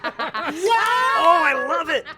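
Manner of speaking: loud, high, laughing cheer; excited cheering. Real, not acted. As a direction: shout it with glee. Whole table cheers the joke.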